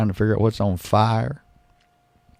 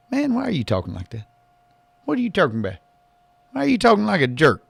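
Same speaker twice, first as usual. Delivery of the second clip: mock bickering other self, higher; lightly voiced sidekick scolding; arguing with himself; 8 semitones higher, faster than usual. Host answers his own sidekick voice, indignant. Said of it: "it's me doing both voices".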